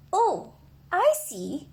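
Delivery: slow, gentle, understanding; kindly rabbit. Acted. Rabbit kindly answering a tearful Douglas. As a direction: soften and understand him. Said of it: "said the rabbit kindly".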